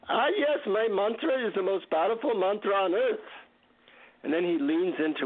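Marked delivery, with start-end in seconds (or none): 0.1-3.2 higher, lilting Indian guru voice; deep Indian accent. Retold or imitated. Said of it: "deep Indian accent".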